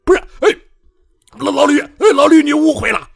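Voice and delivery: high, loud panicked pleading; panicked yelp. A narrator's reading. Bai Jianfei dodging a sword, yelping in panic. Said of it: "白剑飞本能的鬼叫躲闪".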